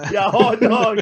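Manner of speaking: high, loud Glasgow voice; Scottish drunk on the street; Glasgow street accent. Retold or imitated. Quoting viral Scottish clip.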